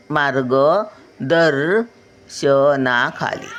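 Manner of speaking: मार्गदर्शनाखाली slowly broken into syllables; slow syllable reading. Shown as a demonstration. Grandmother sounding out मार्गदर्शनाखाली for children, very slowly. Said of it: "reading word for children".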